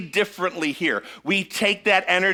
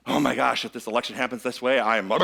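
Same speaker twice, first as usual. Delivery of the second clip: low, hushed mock dismay; lightly voiced fretting voter, trailing off; mock worried voter; 7 semitones lower, 3 dB quieter than usual. Mimicking an anxious voter.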